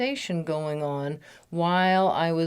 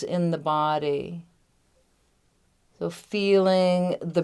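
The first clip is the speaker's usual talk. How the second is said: measured meditation guide with long pauses; unhurried guiding voice, lingering on the key words; guided meditation. Real, not acted. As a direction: lead them gently inward, phrase by phrase. Leads a recorded guided meditation.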